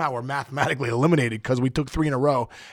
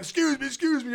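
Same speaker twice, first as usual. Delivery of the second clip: hurried excuse me; 12 semitones higher, 3 dB quieter than usual. Retold or imitated. Bolting through hostile crowd.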